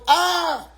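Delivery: high, drawn-out "ah"; imitated child's cry of pain; mock pained yelp. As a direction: yelp as if burnt, playfully. Child touches the hot stove.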